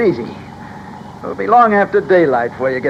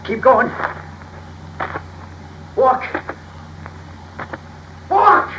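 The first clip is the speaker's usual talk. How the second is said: much higher, sharp command; barked order; 8 semitones higher, 5 dB quieter, slower than usual. Acted. Gunman orders the hostages to keep walking, barked high command. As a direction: snap it out high, a final warning.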